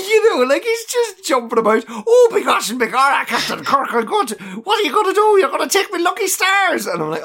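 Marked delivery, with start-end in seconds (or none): 0.1-1.8 high laughing chatter. Real, not acted. Laughing at leprechaun. 1.9-6.9 high, fast stage-Irish leprechaun voice; hammed-up Irish leprechaun. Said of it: "was Finnegan a leprechaun?".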